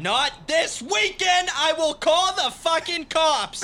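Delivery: furious shouted threat; angry shouting. Acted. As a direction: scream the threat. Acted quarrel, threat.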